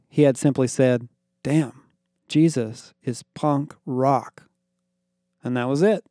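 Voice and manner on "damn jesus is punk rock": slow, flat-toned verdict; slow deadpan. Acted. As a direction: drawl it out, unimpressed. Connor's deadpan verdict on the preacher.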